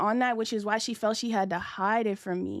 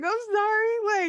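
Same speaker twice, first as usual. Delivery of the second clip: much higher, hammed-up sorry; mock apology; 12 semitones higher, 5 dB louder, slower than usual. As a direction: play it like a fake-innocent oops. Caught filming, mock apology.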